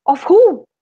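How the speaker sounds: loud puzzled question. Acted. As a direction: blurt it out, puzzled. Husband replies to devil, loud.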